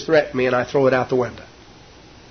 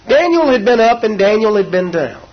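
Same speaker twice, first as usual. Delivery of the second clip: preaching; 7 semitones higher, 4 dB louder than usual. Acted. Preaching at full force.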